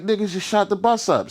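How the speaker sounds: high, alarmed report; urgent alarm. Retold or imitated. Lava warning everyone the bus got shot, high and urgent. Lava telling them of shooting.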